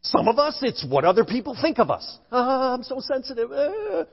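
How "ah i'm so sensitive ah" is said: high, soft, wounded whine; hammed-up fragile little moan; mock whining. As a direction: playfully imitate an oversensitive person fretting. Mimics someone hurt by others' opinions.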